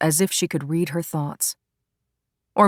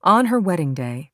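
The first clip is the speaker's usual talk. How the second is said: ordinary delivery, nothing marked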